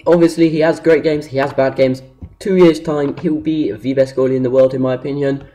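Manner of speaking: usual speaking voice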